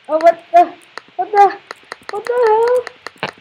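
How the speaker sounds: shocked gamer outburst; startled. Real, not acted. Startled by something in game.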